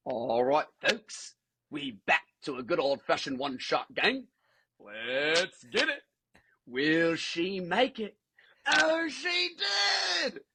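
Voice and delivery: Australian wildlife-show host, drawn out and building to a high shout; hyped-up Aussie commentary, stretched for suspense; Steve Irwin. Shown as a demonstration. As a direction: narrate the big moment like an outback presenter. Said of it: "Steve Irwin kind of vibe".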